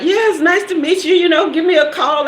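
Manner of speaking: high-pitched, awkward mock farewell; flustered goodbye, acted. Acted flustered goodbye while backing out.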